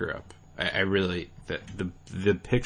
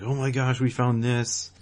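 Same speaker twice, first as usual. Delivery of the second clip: higher-pitched mock excitement; pumped up; 5 semitones higher than usual. Players reacting to a supposed leak, mock pumped up. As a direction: imitate hyped-up fans, pitch lifted. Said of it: "really pumped up about it".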